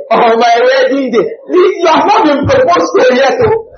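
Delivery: high, loud mock-crying wail; mocking Sister Shola. Mocking Sister Shola dreaming of her wedding, hammed-up wail. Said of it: "Sister Shola".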